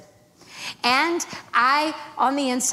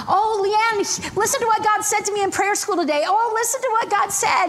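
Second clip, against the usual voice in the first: much higher, fast, mock-enthusiastic imitation; gushing roommates; 9 semitones higher than usual. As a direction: parody the roommates' breathless excitement. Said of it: "they would say to me".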